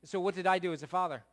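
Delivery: loud fast rhetorical question; preaching. Acted. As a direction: fire it at the room. Preaching at full force.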